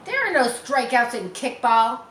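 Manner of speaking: loud, high objection; complaining protest. Acted. Harry protesting to Mary, loud whiny complaint. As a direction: argue it's not fair.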